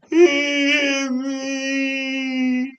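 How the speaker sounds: put-on high, very slow child's wail; mock crying. Five-year-old keeps tattling to mom, hammed-up wail. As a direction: stretch every word into a pretend bawl. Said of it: "Boy, stop crying".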